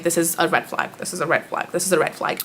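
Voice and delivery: soft, low repeated mutter; muttering to herself. Retold or imitated. Vlogger recalling her inner alarm, muttered quietly. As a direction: keep it under your breath like a silent warning. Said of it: "I was, like, counting it".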